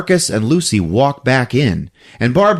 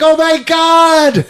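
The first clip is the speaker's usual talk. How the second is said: drawn-out, much higher and louder gasp of disbelief; slow amazed cry, pitched way up; stunned disbelief; 5 dB louder, slower than usual. Retold or imitated. Barbie cannot believe her luck.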